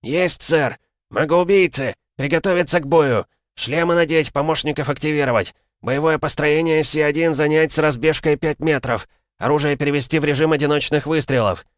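barking battle orders. Acted. Sergeant barks battle commands.

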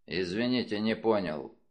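low, grumbled apology; grumble. A narrator's reading. Detective Wolfe answers a jibe he missed, a low grumble. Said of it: "буркнул Вульф".

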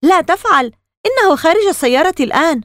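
high-pitched, agitated warning; nervous. A narrator's reading. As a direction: warn urgently, on edge. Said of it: "قالت مارغريت بعصبية". (تحذير بعصبية ونبرة عالية)

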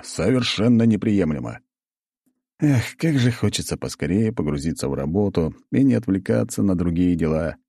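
plain reading voice

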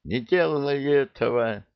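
high frantic cry, pleading; desperate scream. A narrator's reading. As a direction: shriek it to stop him. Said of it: "Ника истошно".

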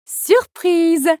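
high-pitched, delighted announcement; exclaiming. A narrator's reading. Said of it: "s'exclama la reine".

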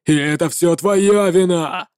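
a grieving knight shouting his grievance; angry paladin. Acted. Paladin blames the warlock.

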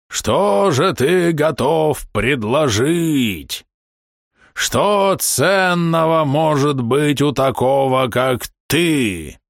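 slow, high, looming demon herald; rumbling herald creature. A narrator's reading. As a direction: draw out every word with contempt. The herald mocks the offer.

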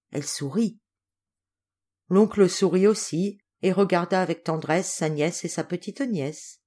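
plain reading voice

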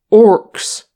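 sudden loud exclamation; sharp, loud outburst of alarm; exclaiming. A narrator's reading. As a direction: burst out with one loud word. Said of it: "Gimli exclaimed".

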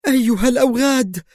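high, trembling scream. A narrator's reading. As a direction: scream it at the thugs. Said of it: "وهي تكرر صرختها".

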